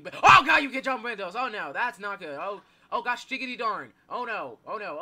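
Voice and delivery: loud, high burst of alarm; panicked yelling. Real, not acted. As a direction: cry out as the monster gets through. Monster can jump through windows.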